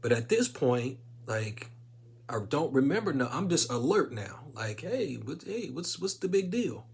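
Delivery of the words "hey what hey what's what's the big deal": higher, chipper and puzzled; perky surprise. Retold or imitated. To ER staff, higher chipper surprise on waking. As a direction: pop up bright, puzzled at the fuss.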